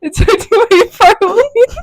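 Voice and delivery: high squeals swallowing the words; laughing to tears. Real, not acted. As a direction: keep failing to speak through laughing sobs. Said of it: "I don't know why I'm crying".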